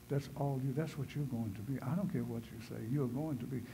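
ordinary talk, nothing marked

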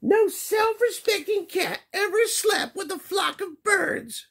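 very high scolding mother voice; scolding mama. Acted. Mama cat scolding Skippy for sleeping with birds. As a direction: wag a finger in a high mom voice. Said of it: "she scolded".

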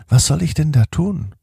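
plain reading voice

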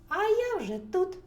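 loud, high hedgehog cry; triumphant call. A narrator's reading. Hedgehog tells the racing hare it is already there, triumphant call.

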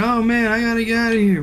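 a mock-scared cry to flee; scared whine. Scared hikers want out of the woods, panicky whine. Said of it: "they're scared".

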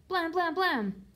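three high, loud bangs voiced; loud knocking noise. A narrator's reading. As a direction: imitate pounding on a door. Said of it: "knocked on the door".

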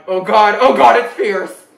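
overwhelmed by the taste, loud; loud delight. Real, not acted. Reviewer biting into the Baconator, loud excited delight. First bite of the burger.

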